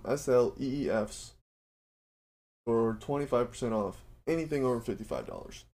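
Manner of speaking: upbeat sponsor read for listeners. Acted. Host pitching Built Bar to his listeners, ad read. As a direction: sell the protein bar like a radio spot.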